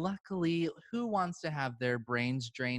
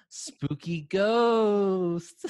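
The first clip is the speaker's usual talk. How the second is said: slow, drawn-out spooky ghost voice; 5 semitones higher, 6 dB louder, much slower than usual. Pretend. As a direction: stretch it out like a ghost.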